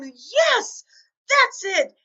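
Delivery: high, loud burst of triumph; cheering out loud. Retold or imitated. Reader cheers aloud at lunch over a thrilling book, high and loud. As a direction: cheer like the good guys just won. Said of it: "I actively cheered out loud".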